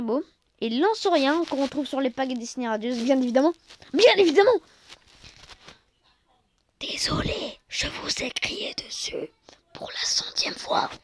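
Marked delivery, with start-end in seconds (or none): 3.9-4.6 "Bien évidemment" said louder and higher; emphatic repeat. Real, not acted. Repeating his own remark, louder and emphatic. 6.8-10.9 soft apologetic whisper. Real, not acted. Apologizing to viewers for shouting, whispered.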